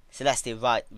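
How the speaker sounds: ordinary delivery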